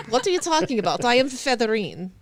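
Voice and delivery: put-on haughty swan Featherine in a hinted Russian accent; offended Russian swan introducing herself. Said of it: "The Russian accent is".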